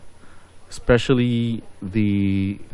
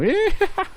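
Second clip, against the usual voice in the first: squeaky laugh. Pretend. Pretend hit by trap in game.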